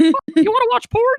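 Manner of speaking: high mock Mickey offering something naughty; Mickey Mouse voice. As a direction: keep the Mickey squeak going. Same boy still in Mickey voice.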